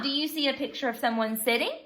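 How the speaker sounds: ordinary talk, nothing marked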